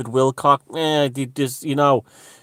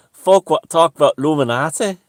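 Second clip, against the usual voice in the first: loud, higher-pitched mock Yorkshire; thick Yorkshire accent; 5 semitones higher, 9 dB louder than usual. Retelling a woman at the club event, thick Yorkshire accent. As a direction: imitate a broad Yorkshire woman, projecting loudly. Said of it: "I can't do a good Yorkshire accent".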